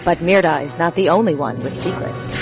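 ordinary talk, nothing marked

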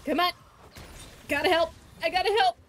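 loud urgent cheering on; excited urging. Real, not acted. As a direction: urge him on, loud and eager. Urging teammate during a fight.

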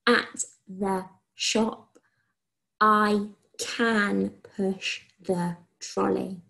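very slow, each word spaced out; slow dictation. Shown as a demonstration. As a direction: dictate it slowly for children to write. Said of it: "Let me try again".

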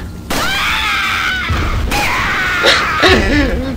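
shrieking burst of laughter. Real, not acted. Wild laugh at a mistake.

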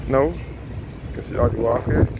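normal talking voice

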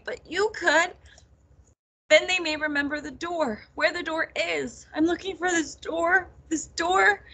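high, urgent, upset rush of explanation; tearful. A narrator's reading. Nettle begs her cousin to help their mothers, tearful. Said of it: "the tears streaming down her face".

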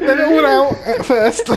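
slow, high words through laughter; laughing. Real, not acted. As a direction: squeeze it out laughing. Laughing while speaking.

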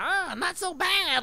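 high, slow goofy voice; silly, stretched-out, high-pitched clowning; all stupid. Retold or imitated. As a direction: play the fool to get a laugh. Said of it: "like, all stupid".

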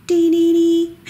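high, loud sing-song jingle; truck jingle. Pretend. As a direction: chime it out like an ice cream truck. Imitating the ice cream truck jingle.

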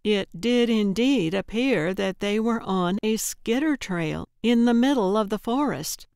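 ordinary delivery

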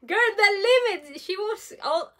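overdramatic, laughing exclamation; dramatic outburst. Real, not acted. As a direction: play up the outrage with a laugh. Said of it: "I'm so dramatic".

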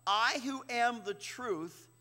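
slow, weighty stress on I; emphatic paraphrase. Shown as a demonstration. Explaining Jesus's words to the class, slow and emphatic. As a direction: lean into "I who am the truth" slowly. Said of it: "It's emphatic in the Greek".

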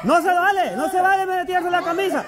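high-pitched hurried urging and protest; urgent calling out. Real, not acted. Game host urging the teams on and calling fouls. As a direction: push the teams to hurry.

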